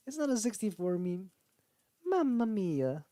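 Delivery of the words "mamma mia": high, slow, hammed-up Italian plumber; Mario voice. Imitating Mario's catchphrase for the viewers. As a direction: stretch out mamma mia like the cartoon plumber. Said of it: "my Mario voice".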